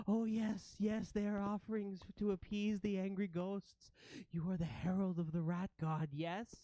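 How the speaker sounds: eager-to-please ratling. Acted. Ratling explains offerings to feared ghosts, high appeasing voice. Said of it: "and she says".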